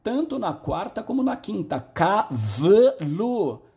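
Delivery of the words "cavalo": "cavalo" drawn out very slowly, syllable by syllable; slow syllable demo. Shown as a demonstration. Teacher slowly sounding out "cavalo" for students. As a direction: stretch out "cavalo" for the class. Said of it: "Ela já está num silábico alfabético".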